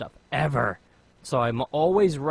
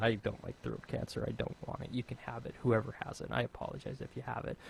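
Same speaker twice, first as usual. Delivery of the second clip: quiet; 10 dB quieter, faster than usual. Real, not acted. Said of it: "using my voice like that".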